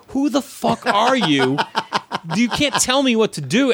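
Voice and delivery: high-pitched mock rebuke; indignant imitation. Retold booker rebuking the newcomer.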